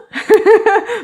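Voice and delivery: a high, loud burst of laughter. Real, not acted. Mom laughing at her son's surprising news, high burst of laughter. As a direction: laugh out, caught off guard.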